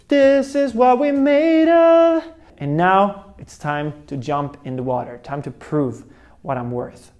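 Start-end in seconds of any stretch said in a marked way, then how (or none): 0.1-2.2 hammed-up boast. Psyching himself up.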